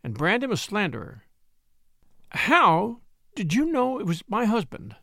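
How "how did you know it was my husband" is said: frightened widow stumbles over the words; stammering. A narrator's reading. Widow asking the stranger about her husband, stammering. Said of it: "she stammered".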